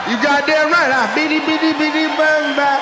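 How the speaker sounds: high, excited sing-song yelp; mock-lustful flirting. Comic flirts with a woman named Selena, high and giddy. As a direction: babble it high like a smitten fool.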